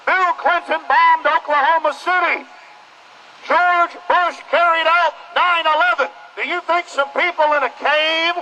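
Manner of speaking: rally the crowd through a megaphone, full force; fiery street-corner sermon through a bullhorn; megaphone protest speech. Real, not acted.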